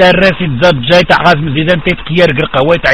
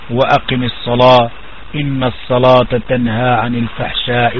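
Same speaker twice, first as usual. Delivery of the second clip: Quran recitation; 8 semitones lower, 6 dB quieter than usual. Acted. Preacher reciting a Quran verse to the congregation, low measured recitation.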